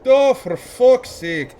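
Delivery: slow, loud exasperated Irish sigh; Irish woman losing patience; Irish accent. Acted. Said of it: "I gotta get Irish".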